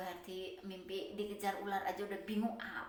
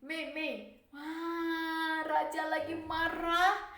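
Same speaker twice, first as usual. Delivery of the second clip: lightly voiced child, slow and higher; a hint of a kid tattling; neighbour's child; 5 semitones higher, 8 dB louder, much slower than usual. Neighbour's child warning Mei the king is angry, lightly voiced. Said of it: "kata anak tetangganya".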